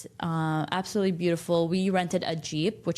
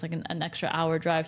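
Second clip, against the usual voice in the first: ordinary talk, nothing marked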